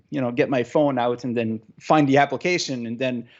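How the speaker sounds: usual speaking voice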